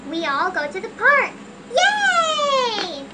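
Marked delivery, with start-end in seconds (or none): 1.7-3.0 excited cheer. Acted. Kids cheer about the park.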